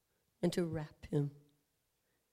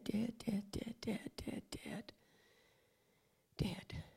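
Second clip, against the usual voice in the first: each dead softer into a whisper; fading repetition; 8 dB quieter, slower than usual. Acted. Preacher dwelling on Jesus' death, fading to whisper. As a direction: let the word die away.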